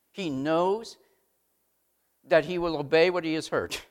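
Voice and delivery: ordinary talk, nothing marked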